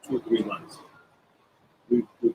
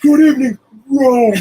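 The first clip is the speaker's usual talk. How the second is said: higher, louder grand announcer hello; imitating Tito's stage greeting; 8 dB louder than usual. Tito greets Milan as Rome, grand stage voice. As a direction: boom it out to the crowd, proudly oblivious. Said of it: "And he goes".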